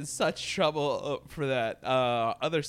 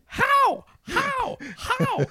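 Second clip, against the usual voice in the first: high, loud repeated disbelief; incredulous; 7 dB louder, much slower than usual. Real, not acted. Host baffled by the scene, high incredulous cries. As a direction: cry out how, baffled.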